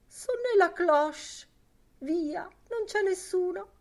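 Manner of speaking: high, loud coaxing voice; insistent urging. A narrator's reading. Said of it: "insisteva Lenehan". (insistenza pressante, voce acuta)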